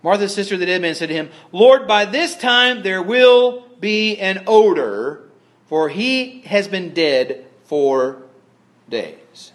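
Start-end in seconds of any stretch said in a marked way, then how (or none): 1.5-9.5 very slow, drawn-out objection, word by word; emphatic scripture reading. Acted. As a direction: start raised and loud, stretch every word, drop on the last. Reads Martha's objection from scripture.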